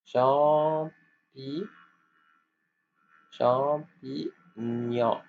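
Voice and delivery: champignon stretched slowly, syllable by syllable. Shown as a demonstration. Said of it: "C H A pour".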